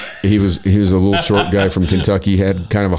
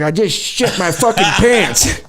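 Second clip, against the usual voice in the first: high, drawn-out mock old-man outburst; old man Earl wailing; 12 semitones higher, slower than usual. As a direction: imitate an old man's slow, high-pitched complaint. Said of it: "He's like".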